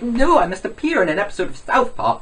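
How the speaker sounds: high-pitched mock impression; mocking, petulant high voice; PewDiePie. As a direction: insist in a put-on rival's voice. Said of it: "This is me doing my impression of PewDiePie".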